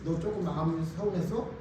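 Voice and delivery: tender God. Retold or imitated. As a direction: speak softly, like comforting a tired friend. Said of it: "그래서".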